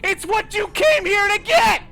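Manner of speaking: very loud, high, slow yelling; furious high yell; exasperated shout. Acted. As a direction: shout it like fed-up staff. Answering as ice cream staff.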